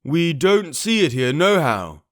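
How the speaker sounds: slow rough pirate answer. Acted. Pirate answers Pugh from the window, slow. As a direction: drag out the bad news. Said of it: "returned the man".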